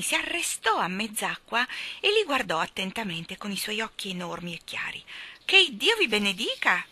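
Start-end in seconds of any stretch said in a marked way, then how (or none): 5.4-6.8 high, loud astonished exclamation; surprised cry. Acted. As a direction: burst out in amazement. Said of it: "gridò sorpresa". (grido sorpreso)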